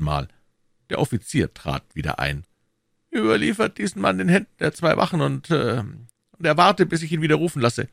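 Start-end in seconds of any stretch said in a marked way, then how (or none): 3.1-7.9 high-pitched voice giving orders; the Cardinal. A narrator's reading. (hohe Stimme des befehlenden Kardinals)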